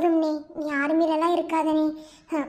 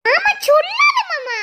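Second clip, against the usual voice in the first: high, slow, laughing shout of embarrassment; laughing protest; 12 semitones higher, 5 dB louder, slower than usual. Acted. Bride laughingly begs her husband not to tell. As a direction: squeal it out through laughter.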